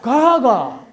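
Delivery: 가가 loud and high with a falling tone; Gyeongsang tone demo. Shown as a demonstration. Lecturer demonstrates two-syllable 가가 tones loudly. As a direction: drop the pitch hard on 가가. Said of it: "두 자로요".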